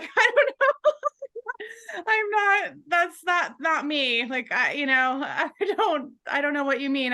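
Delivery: high, half-laughing denial; stunned, laughing disbelief. Retold or imitated. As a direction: laugh through a flustered "not me".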